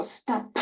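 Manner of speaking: whispered "Stop" with a light P; soft P. Shown as a demonstration. Therapist showing a gentle final P. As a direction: say "Stop" without a hard P. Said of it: "Don't make it go too hard".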